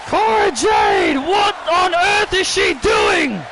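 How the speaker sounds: high-pitched, drawn-out cries of disbelief; shocked outcry. Real, not acted. Commentator aghast at Cora's stunt, shocked outcry. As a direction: cry out, stretching each exclamation.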